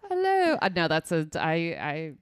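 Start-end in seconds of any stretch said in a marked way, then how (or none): 0.1-0.6 put-on a half-hearted British accent greeting, much higher; a hint of a British accent, pitched up; attempted British accent. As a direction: try a British accent and bail. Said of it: "Do the British accent".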